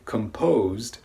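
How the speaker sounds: composed with lips pushed forward on the O; rounded O demo. Shown as a demonstration. Teacher showing lip rounding to learners. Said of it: "notice that my lips protrude forward".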